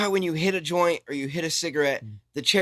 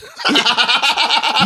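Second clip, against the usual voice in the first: burst of laughter; 8 semitones higher, 3 dB louder than usual. Real, not acted. Laughs at own rant.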